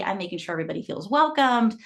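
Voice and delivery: normal talking voice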